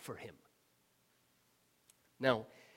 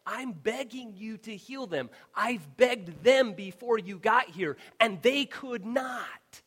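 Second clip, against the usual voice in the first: high, loud, slowing desperate cry; desperate shout; 8 semitones higher, 13 dB louder, slower than usual. Acted. Father begging Jesus to heal his son, shouting. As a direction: cry out to Jesus at the end of your rope.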